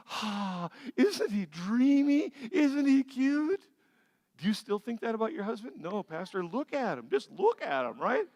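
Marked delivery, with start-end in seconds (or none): 0.1-3.6 high, slow, dreamy gushing over a crush; mock-swooning schoolgirl voice; swooning girlfriend. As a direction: swoon over him like a smitten teenager. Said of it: "Girls, you're with all your girlfriends".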